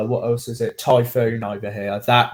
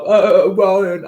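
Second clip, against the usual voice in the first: imitating tipsy mates, louder and higher; drunk lads; 6 semitones higher, 6 dB louder than usual. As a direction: lightly act out rowdy drunken pals calling out. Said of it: "they were there like".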